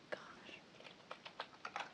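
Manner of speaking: ordinary talk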